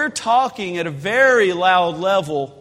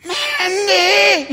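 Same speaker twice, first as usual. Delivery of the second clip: high, harsh, pressed call at full force but breathy; urgent whispered yell; whisper shout; 9 semitones higher than usual. Retold or imitated. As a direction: shout it in a whisper so the deer stays. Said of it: "it's a whisper shout".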